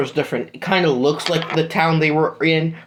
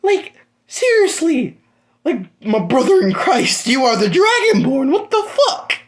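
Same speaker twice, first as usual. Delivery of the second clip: much higher, laughing disbelief; incredulous disbelief; 10 semitones higher than usual. Acted. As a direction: squeak out the disbelief through a laugh. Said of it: "and he's like".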